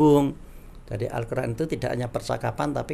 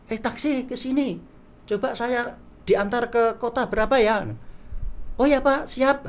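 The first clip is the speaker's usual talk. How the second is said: calling a taxi; 10 semitones higher than usual. Shown as a demonstration. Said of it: "naik taksi".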